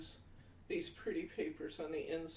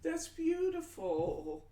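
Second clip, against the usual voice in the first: high, slow, drawn-out "that's beautiful"; swooning over the idea; gushing; 12 semitones higher, 4 dB louder, much slower than usual. Real, not acted. Reading a sweet viewer comment.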